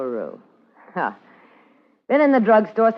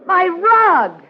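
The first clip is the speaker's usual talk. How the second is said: shocked outburst; 13 semitones higher, 6 dB louder than usual. Acted. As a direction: pitch jumps high at the sight. She finds blood on rug.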